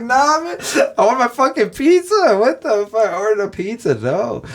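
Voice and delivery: hammed-up indignant customer in a silly squeak; mock outraged whine. To Domino's on the phone about the cancelled pizza, squeaky high mock outrage. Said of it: "I'm like".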